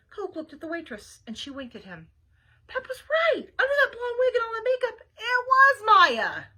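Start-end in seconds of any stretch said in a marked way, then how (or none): 2.7-6.4 high, loud, astonished narration; dramatic storyteller's discovery; excited reveal. A narrator's reading. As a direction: build to a big reveal, stretching the last words. Narrator reveals waitress is Maya.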